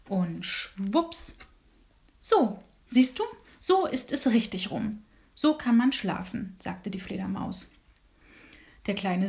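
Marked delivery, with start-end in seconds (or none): 2.2-6.6 a higher, brighter-pitched little bat voice; perky bat. Acted. Bat shows the little dormouse how to hang upside down, perky and high. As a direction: show off how easy it is, chirpy.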